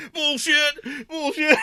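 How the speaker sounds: high mock outrage through amusement; imitated indignant catchphrase. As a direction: mimic his stock offended answer. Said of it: "his answer to everything".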